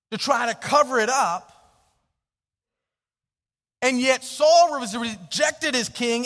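high, loud, slow preacher's build; preaching at full force. Real, not acted. Pastor rising to a loud, slow climax. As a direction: hammer the injustice home to the congregation.

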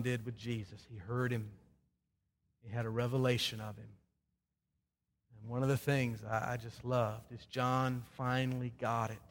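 low, soft and reverent, with long pauses; tender, hushed closing. Real, not acted. Pastor sending the congregation off, tender and hushed. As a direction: bring the message down to a gentle close. Sermon closes softly, tenderly.

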